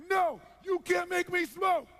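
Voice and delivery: high, loud, slow defiant yell; hammed-up desperate defiance; screamed refusal. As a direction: scream it as a breaking-point protest. Smoker finally screaming refusal.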